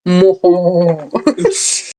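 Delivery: slow, drawn-out villain cackle; evil laugh. Pretend. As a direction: cackle like a sneering panto villain. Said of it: "Evil laugh".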